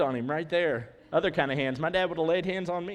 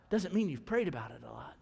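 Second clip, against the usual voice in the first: ordinary delivery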